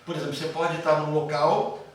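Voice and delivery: usual speaking voice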